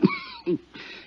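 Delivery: harsh, wracking sick cough; a dying man's chest cough; hacking cough. Acted. Dying inmate coughing hard while talking to Johnny.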